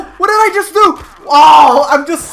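high, loud dismay; exasperated shout. Real, not acted. Gamer botching his own fight, high exasperated shouting. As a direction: wail at your own blunder.

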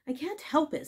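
high-pitched, meek protest; a small, apologetic voice lifted high. A narrator's reading. Alice to the squeezed Dormouse, very meekly. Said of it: "said Alice very meekly".

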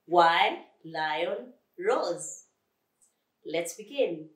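usual speaking voice

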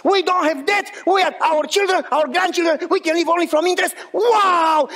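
wife shouting the news to everyone, over the moon; screaming with joy. Retold or imitated. Wife telling everyone about the millions, shouting with joy. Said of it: "She starts screaming and jumping".

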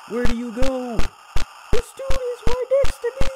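squeaking as the monster closes in; scared high squeal. Real, not acted. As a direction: pitch shooting up in fright. Monster next to him.

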